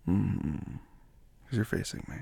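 usual speaking voice

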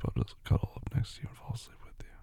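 soft, drowsy whisper; sleepy whisper. Acted. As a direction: whisper it as you drift off. Falling asleep beside partner.

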